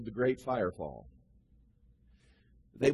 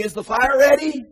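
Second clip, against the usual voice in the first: loud, higher shouted question; calling out; 10 semitones higher, 13 dB louder than usual. Retold or imitated. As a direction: shout the question across the valley. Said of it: "One would say".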